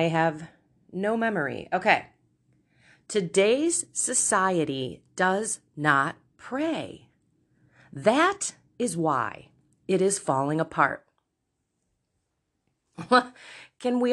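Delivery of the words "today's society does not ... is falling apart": slow, measured reading with pauses between phrases; deliberate quote reading. Real, not acted. Podcaster reading a saint's quote to listeners, slow and deliberate. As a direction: read it slowly, each phrase set apart.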